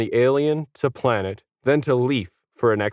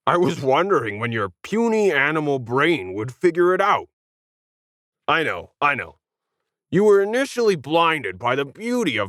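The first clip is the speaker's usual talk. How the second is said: lightly voiced gloating alien; smug alien; 7 semitones higher than usual. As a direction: look down on the monkeys. Alien gloats over humans.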